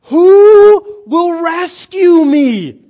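high, very loud drawn-out plea; desperate cry. Acted. Preacher voicing Paul's plea for rescue, crying out desperately. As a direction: cry out slowly for rescue at full voice. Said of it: "And what does he say?".

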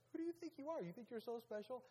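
very high-pitched scoffing challenge; mock taunt. Preacher mimics someone taunting a show-off, high and scoffing. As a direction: needle them in a high, mocking voice.

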